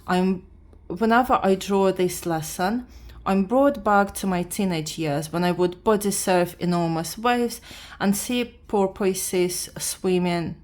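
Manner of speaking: ordinary delivery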